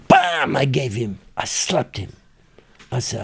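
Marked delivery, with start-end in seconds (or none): 0.1-0.5 a sharp, high, mock "Bam!" for the slap; slap sound. Slapping the boy, sound effect.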